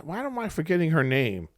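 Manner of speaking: usual speaking voice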